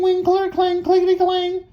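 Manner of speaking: very high cling clang; machine noises. A narrator's reading. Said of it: "that goes".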